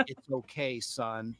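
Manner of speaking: very soft, low reassurance; hushed consoling principal. Retold or imitated. Principal with arm round embarrassed pupil, hushed and consoling. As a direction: drop low and quiet to console a boy.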